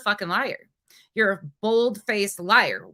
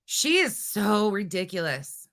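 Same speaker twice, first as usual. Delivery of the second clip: usual speaking voice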